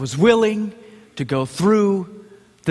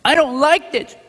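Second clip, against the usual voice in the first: put-on whiny toddler; 8 semitones higher than usual. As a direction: pout like a little child. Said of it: "stop being little Christians that".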